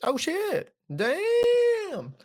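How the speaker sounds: high squeaky mock gasp of surprise; hammed-up surprised squeal; mock shocked falsetto. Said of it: "echoes with high falsetto".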